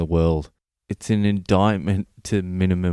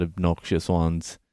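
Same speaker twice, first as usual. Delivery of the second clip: ordinary talk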